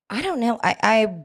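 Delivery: ordinary talk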